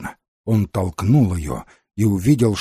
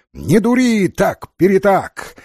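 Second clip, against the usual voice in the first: high, loud scolding cry; angry shout; 8 semitones higher, 4 dB louder than usual. Acted. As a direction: shout after someone, high and cross. Fairy shouting after departing Mark. (сердитый громкий окрик высоким голосом)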